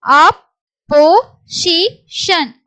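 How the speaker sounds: slow syllables with gaps. Shown as a demonstration. Teacher moves to the next word, syllable by syllable. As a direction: break "opposition" into four separate beats.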